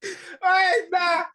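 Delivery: high-pitched outburst of recognition; excited recognition. Real, not acted. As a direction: jump in, amazed at the match. Listener recognises his own story.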